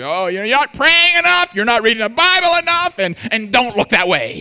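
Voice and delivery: high, loud hammed-up scolding; mock a legalistic preacher berating the flock. Legalistic preacher berating the congregation, high and loud.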